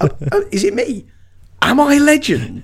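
timid squeaky disbelief; self-effacing, surprised. Pretend. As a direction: play it amazed it could be him. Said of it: "really self-effacing".

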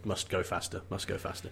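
very soft, low, under the breath; low muttered quote. Retold or imitated. Quoting Ian Malcolm's line in the film, low and muttered. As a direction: drop way down to quote it quietly.

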